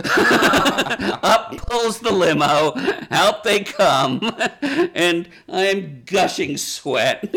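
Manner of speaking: slow words broken by real laughter; laughing while telling. Real, not acted. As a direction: laugh through the story, drawing it out. Laughing through nervous memory.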